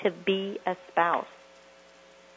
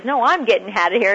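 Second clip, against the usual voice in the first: loud retort; 7 dB louder, faster than usual. Retold or imitated. Wife voiced in quarrel.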